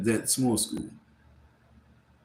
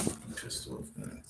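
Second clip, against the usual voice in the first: whispered frustration; 16 dB quieter than usual. Real, not acted. Fed up after a disruptive phone call.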